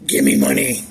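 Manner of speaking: hoarse croaked whisper; croaking. Acted. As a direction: croak it out breathlessly, no voice. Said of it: "croaked Sabrina".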